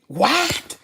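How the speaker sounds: can't believe what just happened; real disbelief; shocked what. Real, not acted. Shocked at reliever blowing game.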